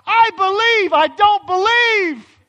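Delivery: very high, very loud cry. Retold or imitated. Torn father shouts his belief and doubt, very high and very loud. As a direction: cry it out with everything.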